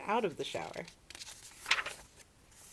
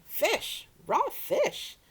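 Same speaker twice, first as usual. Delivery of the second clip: disgusted yell; 7 dB louder, much slower than usual. A narrator's reading. Guest biting raw fish.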